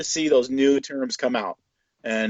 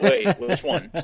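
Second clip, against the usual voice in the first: laughing; 7 semitones higher, slower than usual. Acted. Laughing at mixed-up names.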